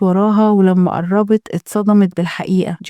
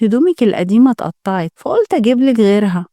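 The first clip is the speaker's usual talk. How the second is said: ordinary delivery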